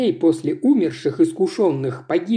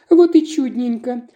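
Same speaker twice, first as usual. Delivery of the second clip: delighted; 8 semitones higher, 5 dB louder than usual. Acted. Mom claps, delighted.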